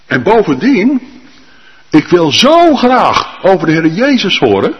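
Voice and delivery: long for it out loud; eager longing. Acted. Lydia voiced eager. (vurig verlangend)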